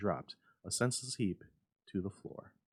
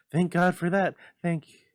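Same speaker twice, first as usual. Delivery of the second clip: relieved cry; 10 semitones higher, 13 dB louder than usual. A narrator's reading. Mother's relief breaks off.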